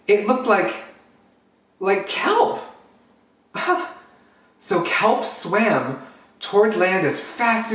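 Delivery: put-on lively storytime narration for kids; storybook reading. Drag queen reads a picture book to children, animated. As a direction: read it out like storytime for little ones.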